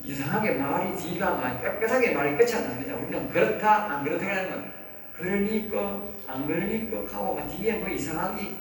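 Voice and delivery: ordinary delivery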